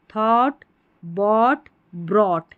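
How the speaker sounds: 'थॉट, बॉट, ब्रॉट' loud, open AW; model word drill. Shown as a demonstration. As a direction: drill थॉट, बॉट, ब्रॉट one at a time. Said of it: "ऐसे आप याद कर लें". ('थॉट, बॉट, ब्रॉट' ज़ोर से, अलग-अलग)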